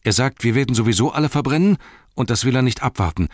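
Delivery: high, fast, agitated blurting; gushing out the story. A narrator's reading. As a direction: spill the news in a panicky rush. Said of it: "So sprudelte Pippin die Geschichte hervor".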